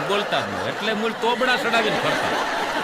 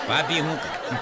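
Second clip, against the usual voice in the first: mimicking nosy question; 5 semitones lower, 3 dB quieter than usual. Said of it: "એમ જ પૂછે આપણને".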